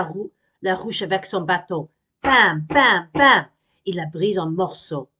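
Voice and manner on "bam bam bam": loud voiced bam sound effects; imitated blows; loud bangs. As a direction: smash the hive. Smashing hive with stick.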